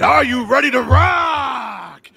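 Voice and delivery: loud, slow, high shout to the crowd; rock and roll shout. Acted. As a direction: yell it out to a concert crowd. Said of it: "rock and roll voice".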